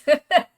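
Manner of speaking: loud high laugh; burst of laughter. Real, not acted. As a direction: crack up mid-sentence. Laughs telling the story.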